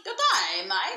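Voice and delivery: badly faked Aussie "Good day, mate" said loud; a bad Australian accent greeting; cheesy Aussie hello; mock Australian. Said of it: "That is not Australian at all".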